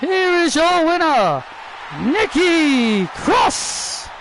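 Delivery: high, drawn-out announcer call; ring announcer. Acted. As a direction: stretch the name for the arena. Ring announcer declaring the winner.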